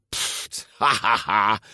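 puff, then laugh derisively; scoffing laugh. A narrator's reading. Ercole mocks the Vespa claim, scoffing laugh.

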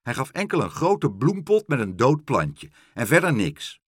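loud, emphatic complaint; exclaiming. A narrator's reading. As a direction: call it out with punched words. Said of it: "riep Karel".

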